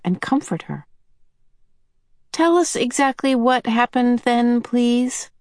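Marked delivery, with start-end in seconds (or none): 2.3-5.3 a lightly voiced small boy, asking. A narrator's reading. Charles Wallace asking Missus Whatsit, small-voiced. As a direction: ask small and careful, like a little boy. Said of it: "in a small voice".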